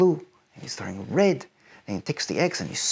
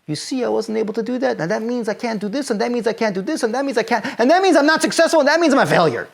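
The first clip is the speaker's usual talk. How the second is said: spiralling self-doubt, building; 7 semitones higher, 4 dB louder, faster than usual. Pretend.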